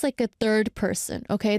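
normal talking voice

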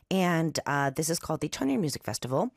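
ordinary delivery

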